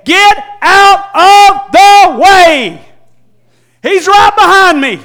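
loud, high shout to clear the way; father shouting at crowd. Retold or imitated. Father clearing the crowd for Jesus, loud shouting. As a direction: bellow at the crowd to move. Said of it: "making a beeline through the crowd".

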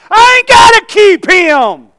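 high, loud, drawn-out preaching cry; overjoyed preacher's holler; exultant shout. Real, not acted. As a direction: shout it slowly, stretching each phrase. Preacher exults over good news.

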